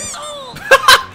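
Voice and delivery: high-pitched burst of laughter; sudden laugh. Real, not acted. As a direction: crack up briefly. Laughs after snowball threat.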